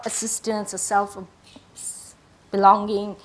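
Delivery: usual speaking voice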